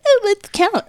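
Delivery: very high sugary parody; hammed-up mom enthusiasm; mock doting mom. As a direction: invite the child with fake delight. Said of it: "very different than Brooklyn's mom".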